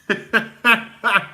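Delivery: loud high cackle; high burst of laughter. Real, not acted. Cracks up.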